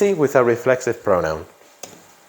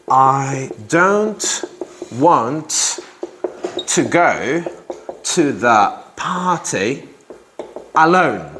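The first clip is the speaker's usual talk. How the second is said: dictating slowly; much slower than usual. Shown as a demonstration. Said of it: "teacher dictating word by word".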